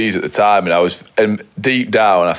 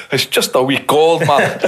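laughing; 8 semitones higher, slower than usual. Real, not acted. Laughing while teasing co-host.

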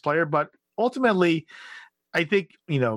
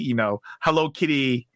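ordinary talk

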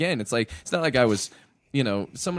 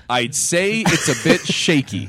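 drawn-out, louder punchline; slow punchline delivery; 5 semitones higher, 7 dB louder, slower than usual. Retold or imitated. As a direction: land the joke slowly and loudly. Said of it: "That's the joke".

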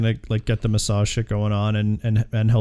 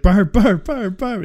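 higher-pitched repeated name, mock breaking character; imitating flustered actor; 7 semitones higher, 3 dB louder, slower than usual. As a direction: imitate an actor cracking up mid-line. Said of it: "and he starts laughing. He's like".

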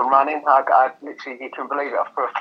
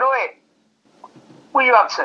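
much higher, louder mock call across the pub; imitating a mate shouting from his seat; calling out Andy; 13 semitones higher, 6 dB louder, slower than usual. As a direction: hail him loudly, half teasing. Pub friend calls out to him.

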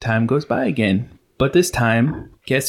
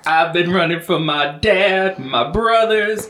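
dramatic line reading; 7 semitones higher than usual. Retold or imitated. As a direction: declaim it like an aggrieved wife. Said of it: "She didn't deliver it like Oprah did".

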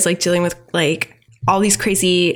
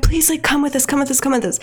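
pleading; 6 semitones higher, faster than usual. Retold or imitated. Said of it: "they're like".

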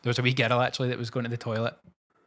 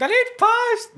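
put-on high, loud, slow child's shriek; child's scream; 10 dB louder, slower than usual. Wee girl screaming at the drinks cart, loud high shriek. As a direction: shriek it like a bratty kid. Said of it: "got to this and screamed".

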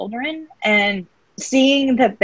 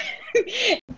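teary laugh; 14 semitones higher, 6 dB quieter than usual. Real, not acted. Fighting tears on camera.

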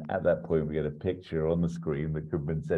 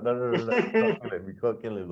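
higher, hurried protesting; mock exasperated; 6 semitones higher than usual. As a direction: frantically talk him down. Stopping Brett from killing Paul.

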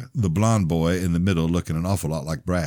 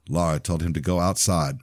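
usual speaking voice

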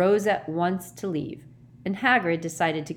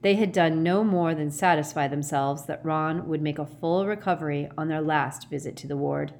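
ordinary delivery, nothing marked

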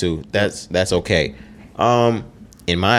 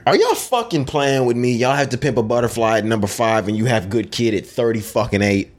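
high-pitched, exasperated outrage; getting mad; 5 semitones higher than usual. Real, not acted. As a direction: start high in indignant disbelief, easing down as you go. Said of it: "I'm getting mad already".

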